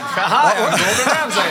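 loud, high teasing introduction; mock Gordon Ramsay. Co-host teases chef about blowing his cover, hammed-up self-introduction. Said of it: "You'd be like".

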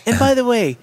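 put-on high British-accent order; ordering at McDonald's in a put-on British accent. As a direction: ask the cashier, pitched high and posh. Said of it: "ordering at McDonald's".

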